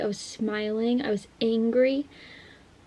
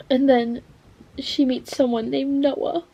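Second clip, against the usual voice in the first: ordinary talk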